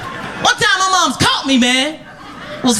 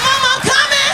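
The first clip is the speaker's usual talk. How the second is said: flustered son; 7 semitones higher than usual. Retold or imitated. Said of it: "I said".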